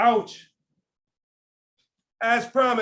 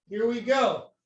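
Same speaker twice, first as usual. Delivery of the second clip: ordinary delivery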